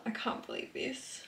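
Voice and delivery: whispered, breathless disbelief; a stunned whisper to camera; awed whisper. Real, not acted. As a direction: hush it like you can't believe your luck. Stunned by [name] ticket.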